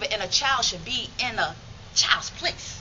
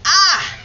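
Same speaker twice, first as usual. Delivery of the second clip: pained yelp; 8 semitones higher, 12 dB louder than usual. Retold or imitated. Kicking boys in the balls.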